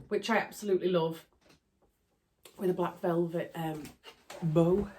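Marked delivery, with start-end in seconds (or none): none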